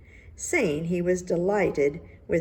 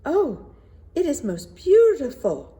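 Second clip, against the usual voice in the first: higher and louder, rushing to sound impressed; hasty gushing praise; 9 semitones higher, 7 dB louder than usual. A narrator's reading. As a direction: blurt out the praise quickly, eager to please. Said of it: "said the minister quickly".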